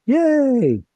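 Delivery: high, drawn-out little hooray; playful cheer. Pretend. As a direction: cheer it way up high, lightly hammed-up. Teacher cheers that marks are reliable.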